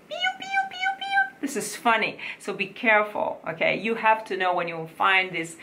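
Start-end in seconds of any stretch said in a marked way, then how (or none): 0.1-1.4 tiny chick cheeping piu piu, far higher; chick chirp. Shown as a demonstration. Coach mocking the wrong più as a chick, very high. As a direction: peep it like a baby chick. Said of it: "this is like a chick".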